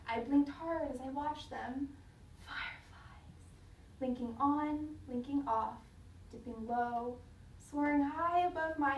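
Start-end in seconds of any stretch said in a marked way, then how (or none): 2.4-3.3 soft, awed whisper; hushed whisper in the dark. Acted. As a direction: breathe the word in wonder. Child watches fireflies in awe.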